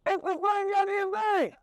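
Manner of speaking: very high, drawn-out protest; exasperated outburst. Real, not acted. Comic answering a senator's excuse for racism, exasperated outburst. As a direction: pitch leaps up in disbelief.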